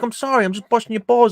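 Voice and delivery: high-pitched mock apology; imitated placating. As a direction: play brushing off an offence. Acted bar argument, placating.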